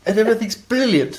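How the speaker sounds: playful imitation British; mock British. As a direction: join in the British bit through laughter. Joins in the mock British accent.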